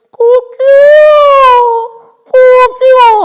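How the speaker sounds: piercing, drawn-out cock-a-doodle-doo; rooster crow. Retold or imitated. Rooster crows after Peter's denial, long high screech. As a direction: crow at full volume like a rooster. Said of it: "하고 막 울었어요".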